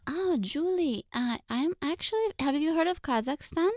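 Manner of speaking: playing her Russian mom; mom impression. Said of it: "sometimes just talk as our moms".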